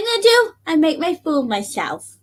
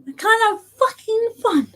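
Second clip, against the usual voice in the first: higher, fast indignant outburst; 10 semitones higher than usual. Real, not acted. Drunk streamer venting at a hater, indignant. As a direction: fire it back, pitch jumping up.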